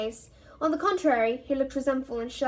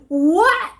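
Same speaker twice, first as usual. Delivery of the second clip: high, loud shocked cry; Fred stunned by the news; 7 semitones higher, 11 dB louder than usual. Acted.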